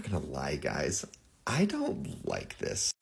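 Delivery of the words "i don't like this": uneasy. Real, not acted.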